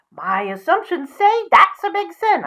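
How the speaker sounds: high pig refusing the wolf; little pig voice. Acted. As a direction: squeak it defiantly. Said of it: "I'm not very good at doing voices".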